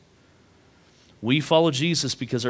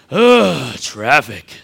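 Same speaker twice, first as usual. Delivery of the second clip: put-on toddler's groan; 7 dB louder, much slower than usual. Two-year-old in the back seat mimicking his dad's groan. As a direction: copy dad's fed-up groan like a little kid. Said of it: "I hear from right behind me go".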